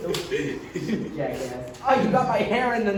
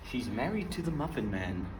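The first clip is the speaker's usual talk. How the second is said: low voice; 7 semitones lower, 10 dB quieter than usual. Acted. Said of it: "That's too slow".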